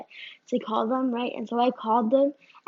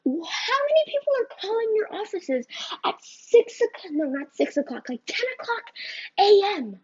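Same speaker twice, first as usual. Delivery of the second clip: high, loud exasperated rant at the company; acting out frustration, pitch up and loud; exasperated quote; 8 semitones higher, 4 dB louder than usual. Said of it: "And I'm just like".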